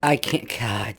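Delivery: ordinary talk, nothing marked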